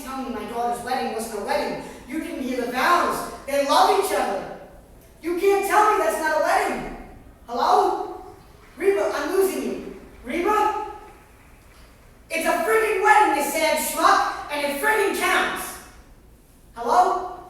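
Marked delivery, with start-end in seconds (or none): 2.1-10.9 raised, higher insistent voice; heated phone call. Real, not acted. Mother insisting to Reba on the phone, getting louder. 12.3-15.8 angry shout. Real, not acted. Mother yelling at Reba, thinking the line is dead. 16.7-17.4 loud, high questioning call; calling into the phone. Real, not acted. Mother checking if Reba is still on the line.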